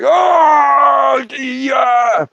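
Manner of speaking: high, jolted awake; startled oh yeah. Retold or imitated. Woken by the yelling thumb, startled high oh yeah. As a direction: caught off guard, waking up.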